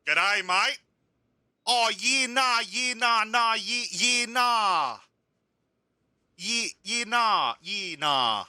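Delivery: high-pitched, hammed-up Australian accent. New driver greeting the boss, hammed-up Australian accent. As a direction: put on a broad Aussie accent, pitched well up. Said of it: "how to do an Australian accent".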